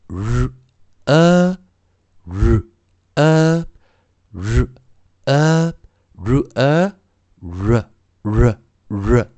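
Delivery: very slow "R", then "uh", closing up into ruh; r blending into schwa. Shown as a demonstration. Teacher shows how the sound is built, slow blending of r and schwa. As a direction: sound out "R" and a schwa apart, then join them. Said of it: "再搭配我们的schwa".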